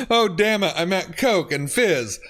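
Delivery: ordinary talk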